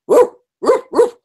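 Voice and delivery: high, loud woofing bark; dog barking. Pretend. Showing the kids her Saint Bernard pen, a high loud bark. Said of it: "I also have a dog pen".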